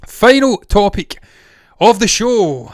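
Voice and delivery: loud, high, drawn-out announcement; segment announcement. Real, not acted. Host to viewers introducing the final topic, loud announcement. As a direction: announce it big and slow.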